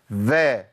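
أف said as a hard breathy puff; fa released with a puff of breath. Shown as a demonstration. Teacher demos a wrong puffed f to students. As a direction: stab the lip and push out أف. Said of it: "لا، ده خطأ".

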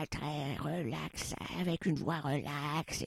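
slow, soft, lazy delivery; a sleepy, relaxed Duras-style drawl. Retold or imitated. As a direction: drag each word out, half asleep. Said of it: "elle parlait comme ça".

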